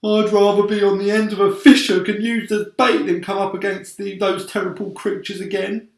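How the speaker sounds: high, loud and pleading; earthworm voice. A narrator's reading. Earthworm pleads with friends, high and loud. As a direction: voice a frantic earthworm begging. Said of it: "said the earthworm".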